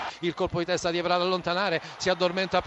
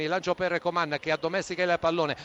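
normal talking voice

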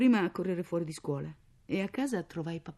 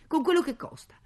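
loud, higher exasperated tirade; indignant shouting; 5 semitones higher, 9 dB louder than usual. A narrator's reading. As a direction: scold and shoo the child off. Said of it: "gridò lei". (sfuriata gridata)

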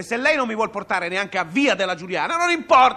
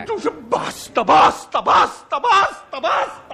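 basta rising higher and louder; building shout; 6 semitones higher, 4 dB louder, much slower than usual. Acted. Passenger losing it at the cabbie, escalating yell. Passenger cracks at driver's teasing.

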